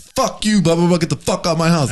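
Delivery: high mock shouting at a friend; mock angry yell. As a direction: yell it like a fake fight. Acted quarrel example.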